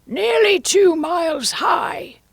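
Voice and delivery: high, loud, drawn-out queen voice; imperious queen. A narrator's reading. Queen accusing Alice in court, high drawn-out royal voice. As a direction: draw it out high and forceful.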